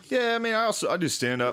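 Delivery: mock douche driver, pitched high at the start; show-off driver. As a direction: casually brag to a passenger in a high put-on voice. Douche driver impressing a hot chick.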